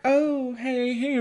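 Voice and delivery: high, soft sweet grandma greeting; sweet old lady calling out; old woman voice. Retold or imitated. Grandma greeting the visitors, high and soft.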